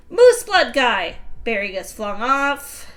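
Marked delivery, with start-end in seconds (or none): 0.1-1.2 loud call. Acted. Character calling out to friend.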